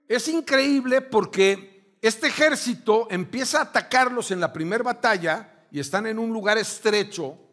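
ordinary delivery

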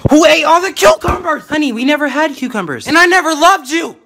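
high, loud furious outburst; shrill angry yelling. Acted. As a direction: scream it at your partner. Acted couple quarrel, loud.

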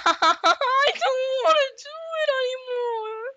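high squeaky fake sobbing; mock crying. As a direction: whimper it like a cartoon crybaby. Fake crying while being hit.

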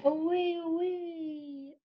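soft fading away. Acted. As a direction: let it drift off into the distance. Kite drifting away, read aloud.

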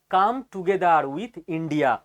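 very slow, word by word; slowly. Shown as a demonstration. Repeating the quote slowly for viewers, very slow. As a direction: spell out each word slowly. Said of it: "আস্তে আস্তে বলছি".

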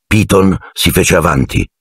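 usual speaking voice

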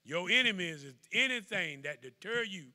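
loud preacher's drive; preaching at full force. Acted. Preacher promising the flock God stays with them, loud.

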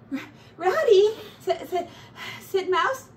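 high, loud stammering; shaken stammer. A narrator's reading. Mouse, still shaken, tries to thank Ratty. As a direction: stumble over words, still scared.

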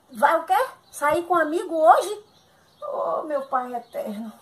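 loud, high-pitched outraged questions; indignant disbelief. Acted. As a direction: confront your husband, voice jumping up. Wife learns husband is going out.

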